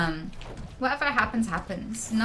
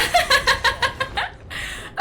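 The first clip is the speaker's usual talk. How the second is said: high laugh; 5 dB louder than usual. Real, not acted. Laughs at own forgetting.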